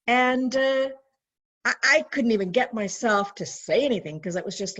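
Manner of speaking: normal talking voice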